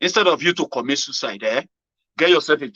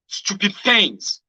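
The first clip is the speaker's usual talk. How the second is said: ordinary talk, nothing marked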